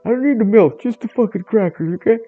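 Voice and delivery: high, loud simple-minded cat; squeaky food-obsessed dimwit begging; dopey cat Jeff. Acted. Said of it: "Jeff voice".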